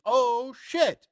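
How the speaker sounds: high, drawn-out oh shit; mock alarm, pitched well up; acted startled reaction. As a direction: react like it just went wrong.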